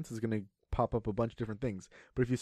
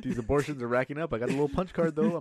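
ordinary talk, nothing marked

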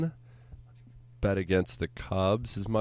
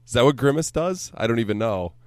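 normal talking voice

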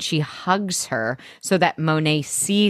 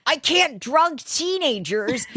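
mock Kate line; 6 semitones higher than usual. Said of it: "Kate is like".

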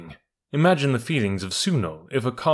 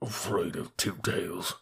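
much softer, slow mutter; half aloud; 3 semitones lower, 10 dB quieter, slower than usual. A narrator's reading. Bullocks and camel scoffing at the elephant, half aloud. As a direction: keep it under the breath. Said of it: "half aloud".

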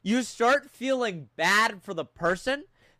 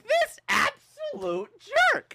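mock outrage. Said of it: "me being like".

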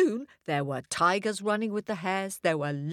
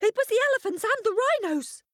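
much higher, fast tattling cry; blaming shout; faster than usual. A narrator's reading. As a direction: pass the blame quickly. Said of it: "they shouted".

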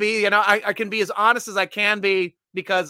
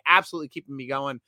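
ordinary talk, nothing marked

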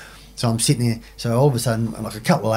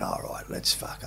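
hushed unvoiced whisper to himself; whispering in the cell on realising it's over; 14 dB quieter than usual. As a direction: breathe it out, barely audible, caught out. Retold aside to himself in the cell.

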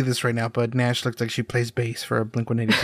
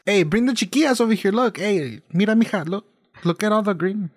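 put-on high-pitched Chicano parent calling the kids; California Mexican accent; 6 semitones higher than usual. Fairy showing the kids all the green, California Mexican accent. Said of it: "Just very California Mexicans".

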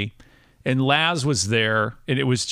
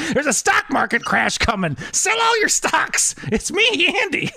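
high, put-on alarm climbing higher; mock warning voice; 13 semitones higher than usual. Prank caller warning Andy as his future self, high put-on voice. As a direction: warn him in a squeaky mock-urgent voice. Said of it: "I wanna warn you".

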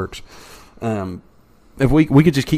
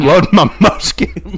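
laughing; 6 semitones higher, 3 dB louder, slower than usual. Pretend. Joking musket line.

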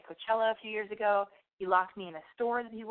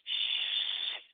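a hissed shush; mock shush. Abercrombie people hushing the comedian, mock shush. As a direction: shush them like a prissy neighbour. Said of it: "these Abercrombie people turn around and go".

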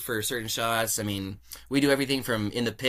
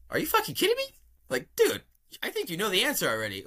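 much higher, near-shrieked disbelief; recalling the moment, voice shooting way up; excited disbelief; 11 semitones higher, faster than usual. Retold or imitated. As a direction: squeal like you can't believe your luck. Stunned by the job offer.

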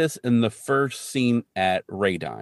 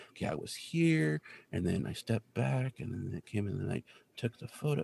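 reenacting under his breath; 11 dB quieter than usual. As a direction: talk yourself quietly through the steps. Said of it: "reenacting the events of the attack".

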